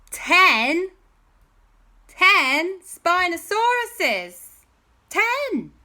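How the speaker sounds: loud, high, drawn-out storyteller reveal; dramatic reveal. A narrator's reading. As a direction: build the count to a high, loud peak. Dramatic reveal of ten dinosaurs.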